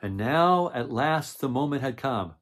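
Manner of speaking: storybook read-aloud voice; reading to children. A narrator's reading. Reads the picture book aloud.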